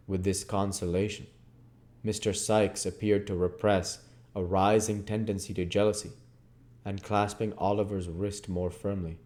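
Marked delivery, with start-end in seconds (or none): none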